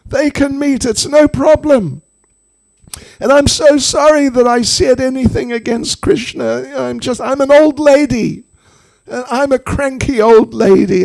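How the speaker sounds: high-pitched, eager appeasement; Jatila's frantic agreement. Acted. Old lady placating the threatening snake, frantic. Woman frantically gives in and apologises.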